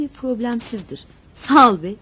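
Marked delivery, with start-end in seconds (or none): 1.3-1.9 curt, louder grumble; miffed muttered retort, given with punch; grumbling. A narrator's reading. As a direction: grumble a sarcastic thanks. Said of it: "diye homurdandım".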